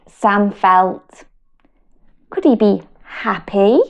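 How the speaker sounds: slow, sing-song picture book reading; reading to children. Acted. Mum reading a feelings book to her children, slow and sing-song. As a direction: draw little listeners into the story.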